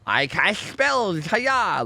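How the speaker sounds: hammed-up nerd wizard, slow on "I cast spells"; mock nerd wizard. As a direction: lampoon a dorky spellcaster. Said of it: "He's like".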